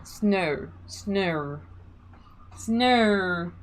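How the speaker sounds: "Snow" stretched out with a long Australian vowel; Australian accent. Shown as a demonstration. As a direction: say "Snow" the way an Australian lady would, drawn out. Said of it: "And I'm saying snow like in Australia".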